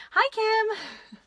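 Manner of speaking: high, loud sing-out hello; excited greeting. Real, not acted. Streamer hails a viewer joining live, excited greeting. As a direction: call out brightly to someone arriving.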